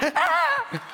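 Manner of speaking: high laughing yelp of fright; a comic frightened squeal; startled shriek. Retold or imitated. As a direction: shriek in mock terror. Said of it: "And I went".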